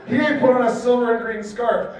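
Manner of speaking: usual speaking voice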